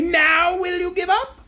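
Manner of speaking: high, slow taunting crab; jeering. Acted. Crab jeering at the zebra, high and slow. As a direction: gloat and jeer at the rival. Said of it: "it cried jeeringly".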